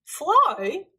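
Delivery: amused disbelief. A narrator's reading. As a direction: echo it as if it were absurd.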